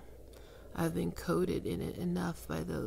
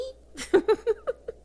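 loud high rising laugh; high mocking laugh; 7 dB louder than usual. Succubus laughing at her pet, loud high laugh. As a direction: cackle at the joke, pitched high.

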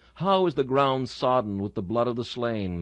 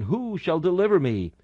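crying out; 7 semitones higher, 3 dB louder than usual. A narrator's reading. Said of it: "the poor fainting soldier cries again".

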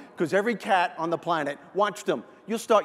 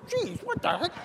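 high, softer, bewildered dog voice; startled dog; 10 semitones higher, 5 dB quieter than usual. Acted. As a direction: squeak it like a baffled dog. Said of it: "The dog goes".

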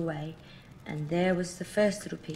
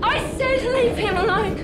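high, loud furious shout; 11 dB louder than usual. Acted. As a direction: scream it with stress on leave. Repeated warning, now shouted.

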